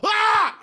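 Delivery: short high mock scream; startled yell. Retelling the theater scare, a high startled yelp. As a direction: yelp like you're jumped. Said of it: "And I go".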